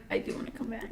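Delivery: whispered, soft and sincere. Retold or imitated. Ava getting real with Janine, a hushed sincere whisper. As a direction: breathe it like a heartfelt confession. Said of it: "She got real. She's like".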